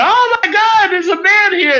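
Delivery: high, excited squeal; excited girls. Retold or imitated. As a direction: shriek it like a starstruck girl. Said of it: "they're like".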